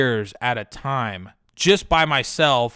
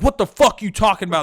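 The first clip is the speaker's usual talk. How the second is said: higher, louder defensive snap; 7 semitones higher, 4 dB louder than usual. Retold or imitated. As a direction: fire back at the accuser. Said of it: "got super, like, defensive".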